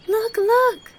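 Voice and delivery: put-on very high, loud child's cry; squealing with high excitement; fresh excitement. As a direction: cry out like a thrilled little girl. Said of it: "cried Heidi in fresh excitement".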